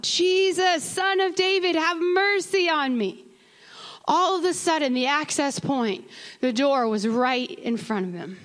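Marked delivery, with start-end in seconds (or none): 0.0-3.2 higher pleading call of the blind man; a lightly voiced beggar calling for help; crying out. A narrator's reading. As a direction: blind beggar calls to Jesus, a higher plea. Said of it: "And he cried out".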